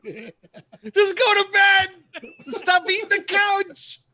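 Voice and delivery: high, drawn-out mock yell; hammed-up parental screaming; exasperated parent shouting. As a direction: holler slowly at a disobedient kid. Acting out parent yelling at child.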